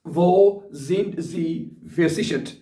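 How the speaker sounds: very slow, every German word spelled out for learners; slow dictation. Shown as a demonstration. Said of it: "ये question भी बड़ा important है".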